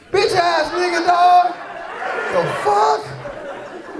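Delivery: father yelling at son over Capri Suns, mock furious. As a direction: blow up at a cheeky eight-year-old.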